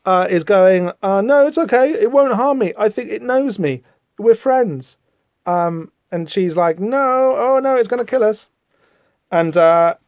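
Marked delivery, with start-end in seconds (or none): 1.0-5.0 naive idiot. Acted. Idiot Jeremy reassures his companion. 6.8-8.5 high mock-frightened wail; fearful protest. She warns it's gonna kill them, fearful.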